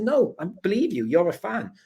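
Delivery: placating. Retold or imitated. Retold placating the fan.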